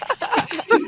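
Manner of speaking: short high-pitched laugh; high giggle. Real, not acted. Laughing after her story.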